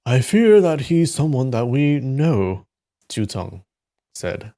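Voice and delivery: expressive read-aloud with lifted dialogue; story narration. A narrator's reading. Reader telling of six conferring about a secret teacher, dramatic narration. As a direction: narrate it, lifting the characters' lines.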